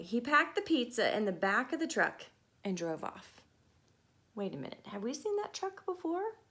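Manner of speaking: plain reading voice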